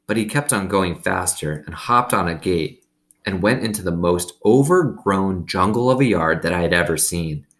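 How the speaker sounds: ordinary delivery, nothing marked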